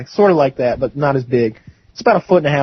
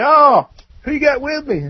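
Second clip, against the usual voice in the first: hearty greeting; 11 semitones higher than usual. Retold or imitated. Acted uncle greets nephew at door.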